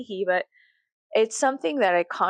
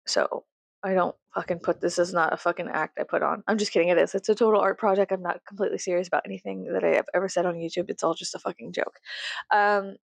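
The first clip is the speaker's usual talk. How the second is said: ordinary delivery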